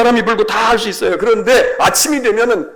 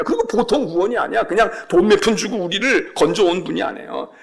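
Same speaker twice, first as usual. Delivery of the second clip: ordinary talk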